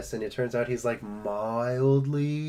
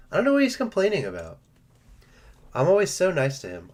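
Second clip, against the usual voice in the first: higher, fast, louder put-upon complaint; mock exasperation; 7 semitones higher, 5 dB louder, faster than usual. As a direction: throw up your hands at the ungrateful pet. Mock exasperation about spoiled pet.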